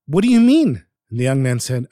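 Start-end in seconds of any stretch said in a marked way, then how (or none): 0.1-0.8 high, loud startled cry; startled question. Acted. Surprised driver asks the young man what he means. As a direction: blurt it out in shock.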